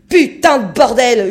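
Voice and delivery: juron hurlé à pleins poumons, plus aigu et ralenti; cri de rage. A narrator's reading. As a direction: hurle ta colère contre la glace. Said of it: "hurla-t-il à l'amas de glace". (le capitaine hurle de rage)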